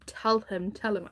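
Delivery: ordinary delivery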